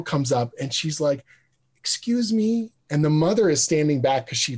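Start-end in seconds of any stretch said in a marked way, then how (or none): 1.8-2.7 put-on light, high, small-girl voice, lightly done; little girl voice. Six-year-old fan greeting the writer, small high girl voice.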